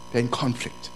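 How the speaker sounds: ordinary talk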